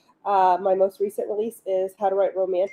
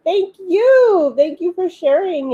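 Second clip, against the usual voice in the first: excited thanks; 9 semitones higher, 7 dB louder, slower than usual. Real, not acted. Thanking a viewer warmly.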